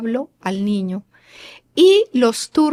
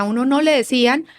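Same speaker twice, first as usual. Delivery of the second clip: ordinary talk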